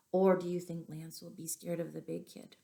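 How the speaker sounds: plain reading voice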